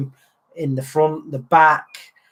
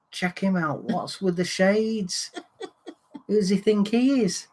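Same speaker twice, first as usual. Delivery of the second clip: mock incredulous stranger, pitched higher; retold scornful remark; 5 semitones higher, 3 dB quieter than usual. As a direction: imitate a scoffing pub-goer, voice lifted. Acts drunk stranger mocking his shades.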